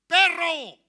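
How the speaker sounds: a sudden high, loud yell of "¡Perro!"; insulting shout. Retold or imitated. Enemies yelling at David, a loud insulting shout. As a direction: hurl the insult like an enemy shouting. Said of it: "¿Qué le gritaron a David?".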